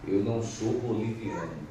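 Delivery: ordinary delivery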